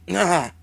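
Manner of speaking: short startled cry. Real, not acted. Sudden reaction to game event.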